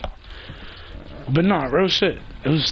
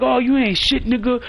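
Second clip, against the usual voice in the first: imitated insult; 6 semitones higher than usual. Rivals insult him.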